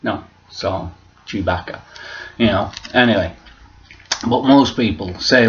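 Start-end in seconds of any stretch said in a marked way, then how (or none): none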